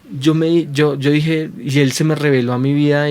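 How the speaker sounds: normal talking voice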